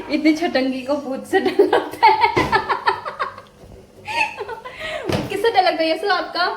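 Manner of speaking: high, giggling tease; teasing laughter. Real, not acted. Teases small child about fear.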